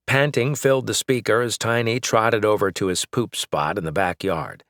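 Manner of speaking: plain reading voice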